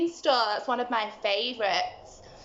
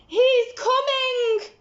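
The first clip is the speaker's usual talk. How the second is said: much higher, louder, slow panicked warning; frightened warning; 13 semitones higher, 6 dB louder, slower than usual. Acted. Giantess warns Jack the giant is coming, panicked. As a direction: warn him in a panic.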